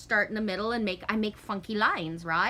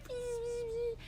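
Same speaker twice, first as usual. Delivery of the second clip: mock begging; 7 dB quieter than usual. Dad begging mom.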